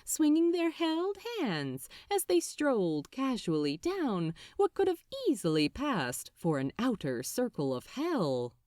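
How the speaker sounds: ordinary delivery, nothing marked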